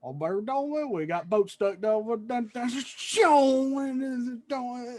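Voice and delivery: garbled, high-pitched Southern caricature; impersonating little brother. Retold or imitated. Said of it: "impersonating my brother and his mannerisms".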